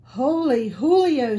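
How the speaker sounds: an excited kitten's squeal. Acted. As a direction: squeal it out in delighted shock. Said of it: "squealed the kitty boy".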